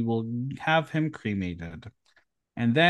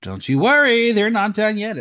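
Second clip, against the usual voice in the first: excited; 6 semitones higher, 6 dB louder than usual. Real, not acted. Hyping up the next look.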